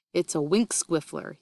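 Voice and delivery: plain reading voice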